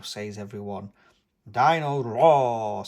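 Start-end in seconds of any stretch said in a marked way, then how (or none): 1.5-2.9 slow, loud, thrilled cry; excited. A narrator's reading. George seeing the toy, slow excited loud call. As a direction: savour the name, thrilled. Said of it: "says George excitedly".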